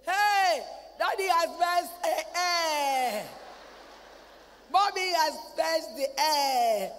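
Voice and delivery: put-on loud high child's shout, half crying. Child shouting to mommy about daddy's gas. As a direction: yell it out like a kid telling on daddy.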